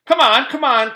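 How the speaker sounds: loud, high hurry-up; impatient. A narrator's reading. Terrence hurrying Louis before recess is over. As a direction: rush the grown-up along.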